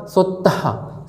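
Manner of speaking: सोत्तहा with an audible "ha" breathed out at the end; breathed final h. Shown as a demonstration. Teacher showing students the visarga, सोत्तहा ending in a breathed हा. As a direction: sound out सोत्तहा slowly, releasing the final हा. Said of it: "आखिरी में ह का pronunciation निकला".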